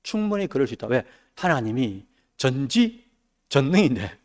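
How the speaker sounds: usual speaking voice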